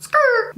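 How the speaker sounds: high, quick screech-word; a tire-skid exclamation; skirt sound. Acted.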